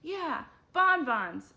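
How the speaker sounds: slow, loud and high cheer; eager agreement. A narrator's reading. The whole class agreeing together, slow and loud. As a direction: stretch it out like a happy crowd. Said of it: "everybody agreed".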